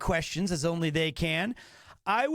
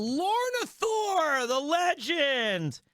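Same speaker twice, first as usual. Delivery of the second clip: excited shout; 7 semitones higher, slower than usual. Real, not acted. Hyping up the video editor.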